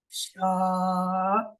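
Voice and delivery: شا with the vowel held for three counts; long madd. Shown as a demonstration. Teacher showing the long madd on شا. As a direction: pull out شا long. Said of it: "تین الف کے برابر".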